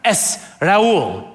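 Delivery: "Es Raul" Spanish-style, no h in the name; Spanish correction. Retold or imitated. Miami locals correcting his name, Spanish-style. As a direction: correct them with "Raul", vowels joined without h. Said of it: "they'd be like".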